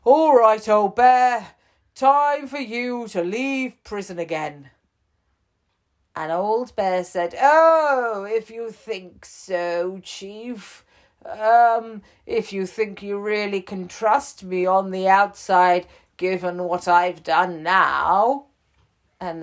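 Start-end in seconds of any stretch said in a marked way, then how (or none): 0.0-4.7 raised, loud and friendly official; a cheerful jailer letting him out; storybook chief, bright and loud; prison chief voice. Acted. 7.4-18.5 high, loud cartoon bear, hesitant; a sheepish bear, unsure about leaving; high storybook bear, a touch sheepish; old bear voice.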